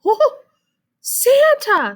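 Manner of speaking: high, loud joyful "Oh, Santa!"; excited call. Acted. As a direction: burst out thrilled. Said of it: "Splat called".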